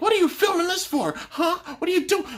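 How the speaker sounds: high, loud mock challenge; hammed-up high accusatory yell; imitating angry confrontation. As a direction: demand it like an outraged woman catching someone filming. Imagined angry woman confronting filmer.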